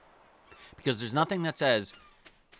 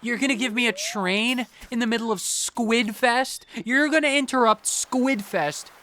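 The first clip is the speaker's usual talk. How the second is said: exasperated disbelief; 10 semitones higher, 3 dB louder than usual. Retold or imitated. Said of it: "I'm like".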